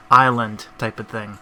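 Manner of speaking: ordinary delivery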